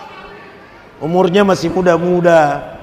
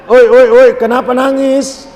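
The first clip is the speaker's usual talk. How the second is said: calling out; 6 semitones higher, 5 dB louder than usual. Retold or imitated. Calling out to a crying congregant.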